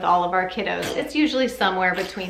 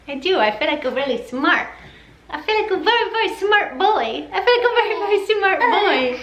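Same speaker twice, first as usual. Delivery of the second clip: high sing-song parentese cooing; 9 semitones higher than usual. Real, not acted. Mother praising baby to his face.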